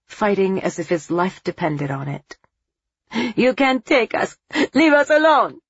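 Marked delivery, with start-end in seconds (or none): 3.4-5.6 put-on frantic child's yell, high and loud; a terrified boy yelling; shouting. A narrator's reading. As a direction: scream it at the attackers. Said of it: "he shouted".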